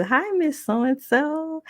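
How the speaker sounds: high, slow, drawn-out hello; cheery greeting. Retold or imitated. Caller greeting the wife as she always does, cheery. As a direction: imitate a sweet singsong phone greeting. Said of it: "Like I always do".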